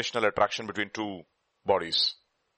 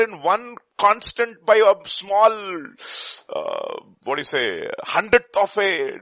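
preaching; 8 semitones higher, 5 dB louder than usual. Acted. Preaching the fine-tuned universe.

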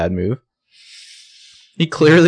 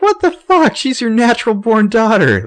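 outraged; 12 semitones higher, 4 dB louder than usual. Real, not acted. Outrage at the father.

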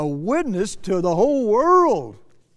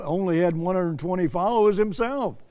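ordinary talk

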